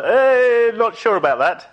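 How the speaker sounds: long high-pitched doubtful "eh"; reluctant reply to God. Acted. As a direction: balk comically, voice sliding up high. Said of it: "I, I said to God".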